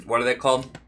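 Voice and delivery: ordinary talk, nothing marked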